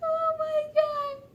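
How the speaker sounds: high, drawn-out squeak after laughing; dying of laughter. Real, not acted. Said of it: "I'm just dying over here".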